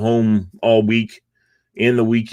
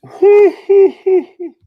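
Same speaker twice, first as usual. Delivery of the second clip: yawning; 10 dB louder than usual. Real, not acted. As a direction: yawn through the words. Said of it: "don't know why I'm yawning".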